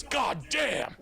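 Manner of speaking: high shouted god damn; mock stunned. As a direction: blurt it, dazed and high. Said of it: "he was like this".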